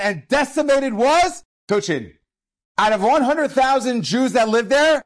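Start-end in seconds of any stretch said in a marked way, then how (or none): 2.8-5.0 emphatic outcry. Real, not acted. Emphasizing massacre scale.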